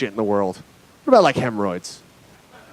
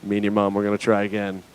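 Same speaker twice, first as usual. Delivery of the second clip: low, soft, matter-of-fact giving up; resigned parent; 7 semitones lower, 7 dB quieter than usual. Retold or imitated. Parent writes off child Billy, quietly resigned.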